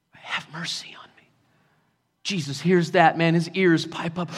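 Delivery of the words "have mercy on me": near-whispered plea for mercy; hushed, acted supplication; humble plea. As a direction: beg very softly.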